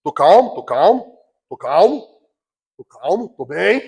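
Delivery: low, rising, forced-calm mantra; mock positive affirmation. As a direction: repeat it as if convincing yourself, each one rising. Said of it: "daquela idiotice da confissão positiva". (mantra de calma forçada, em tom de deboche)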